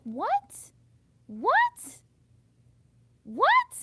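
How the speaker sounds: exasperated disbelieving shriek; incredulous what. Real, not acted. Incredulous repeated shout.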